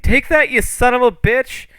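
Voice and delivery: high, loud battle yell; little dwarf. Acted. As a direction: yell it like a furious little dwarf mid-attack. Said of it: "the little dwarf is like".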